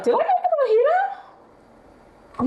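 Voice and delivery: high, slow mock-puzzled question; slow and high, playing dumb. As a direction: ask it like a baffled cartoon. Mock question to herself while cooking.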